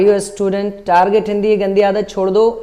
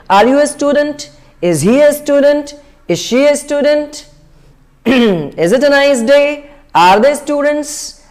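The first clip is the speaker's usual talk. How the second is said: higher, slow questions, each ending up; rising tone; 4 semitones higher, much slower than usual. Shown as a demonstration. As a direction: lift every question's last word. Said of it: "इस तरह से आपको rising tone में बोलना है". (Rising tone में धीरे-धीरे सवाल)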